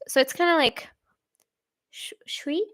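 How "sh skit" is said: "sweet" as soft hushed "shweet", testing the sh; Swedish pronunciation. Shown as a demonstration. Streamer sounding out the word for viewers, Swedish pronunciation. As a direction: try out "sweet" with a rounded Swedish sh, softly. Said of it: "if we say it in Swedish".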